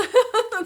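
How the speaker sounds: short high burst of laughter; bright laugh. Real, not acted. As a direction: laugh out loud, delighted. Laughs at own quote.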